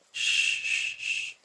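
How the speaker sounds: soft shushing. Acted. Soothing a crying partner, whispered shushing. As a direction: hush them gently like soothing a child.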